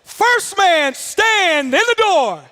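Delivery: high, slow, drawn-out command; instructor's shouted order. Retold or imitated. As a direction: holler the order, stretching key words.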